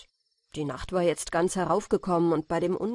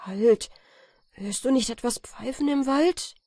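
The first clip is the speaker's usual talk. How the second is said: louder, higher startled cry; frightened call; 6 semitones higher, 4 dB louder than usual. A narrator's reading. Felix calling out in fear to his companion, higher and louder. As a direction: stop him in sudden fright. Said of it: "rief Felix ängstlich".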